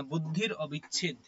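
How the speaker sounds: ordinary delivery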